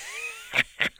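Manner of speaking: sickly, rasping high cackle. Acted. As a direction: cackle like a sick old trickster. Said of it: "laughed a sickly, rasping cackle".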